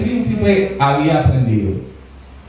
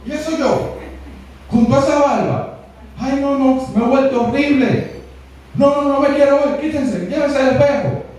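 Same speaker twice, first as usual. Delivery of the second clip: high, shocked wail of a ruined prince; horrified prince; 9 semitones higher than usual. Retold or imitated. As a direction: recoil from the mirror in high-pitched horror. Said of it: "¿qué le hace?". (el príncipe se ve al espejo y grita horrorizado, voz muy aguda)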